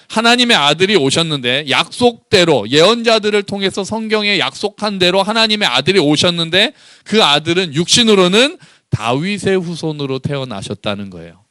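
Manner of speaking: ordinary talk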